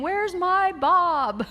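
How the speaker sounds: slow, high whiny complaint; disappointed audience. Retold or imitated. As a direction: whine it slowly like a let-down fan. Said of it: "I could hear people in my imagination saying".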